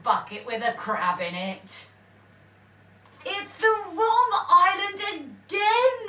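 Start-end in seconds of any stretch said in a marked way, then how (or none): 3.2-6.1 louder, higher groan of disappointment; fed-up pirates. A narrator's reading. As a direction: pirates dismayed yet again. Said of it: "they said".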